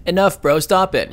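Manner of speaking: high-pitched, jokey outburst; mock protest. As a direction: plead in a high, put-on voice. Joke voice for a gloomy character.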